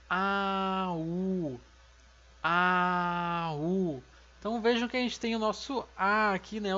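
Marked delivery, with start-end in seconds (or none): 0.1-4.0 A, U, A, U, each vowel in its own beat; modelled syllables. Shown as a demonstration. Teacher modelling little brother in Korean. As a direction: spell the word out vowel by vowel. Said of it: "vai ficar como".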